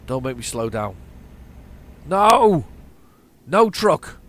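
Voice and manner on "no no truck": loud, high cries of no; protesting shout. Real, not acted. Driver protesting to his truck, loud shout. Truck misbehaves entering town.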